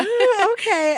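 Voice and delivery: high, loud ooh of alarm; taken aback, backing off; startled. Retold or imitated. Husband reacting to the Legoland plan, startled.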